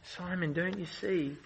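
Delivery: soft, pleading Jesus to Simon; Jesus quietly pleading with Simon; gentle plea. Acted. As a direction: drop the voice and plead.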